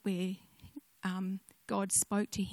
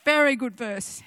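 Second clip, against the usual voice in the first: praising grandmother; 2 semitones higher, 10 dB louder than usual. Retold or imitated. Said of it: "I said".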